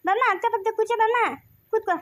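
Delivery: coaxing call. Acted. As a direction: call the child over in a lifted voice. Calls child over to sit. (ಎತ್ತರದ ಸ್ವರದಲ್ಲಿ ಮಗುವನ್ನು ಕರೆಯುವುದು)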